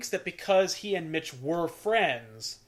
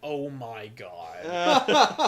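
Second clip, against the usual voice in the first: mock shock; 5 dB louder, much slower than usual. Retold woman shocked at intruder.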